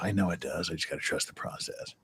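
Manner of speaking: whispered to himself, very soft; quiet whisper. Real, not acted. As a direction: breathe it out as a private whisper. Muttering to himself.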